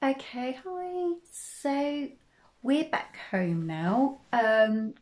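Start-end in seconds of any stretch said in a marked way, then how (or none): none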